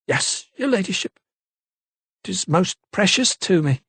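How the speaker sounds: a lightly voiced London maid, unsure; maid's voice. A narrator's reading. Maid Eliza's voice.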